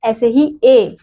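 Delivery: ordinary talk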